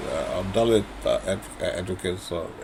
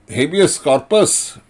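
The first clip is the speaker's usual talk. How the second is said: mocking quote; 7 semitones higher, 9 dB louder than usual. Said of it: "He says".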